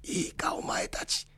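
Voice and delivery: put-on whispered old-man warning. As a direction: breathe it out with no voice. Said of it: "そっちの方が聞きたい".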